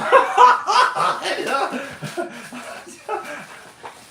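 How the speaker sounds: shrill falsetto cackle; high giggle. Real, not acted. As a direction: burst into a high-pitched giggle. Laughs after silly ditty.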